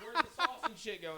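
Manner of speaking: laughing loudly through the quote; laughing mock question. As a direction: crack up while mimicking him. Mocking friend's confusion, laughing.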